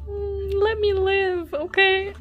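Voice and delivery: high, loud, drawn-out whine; mock pleading. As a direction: playfully wail it, stretching the words out. Mock pleading with idol's photo.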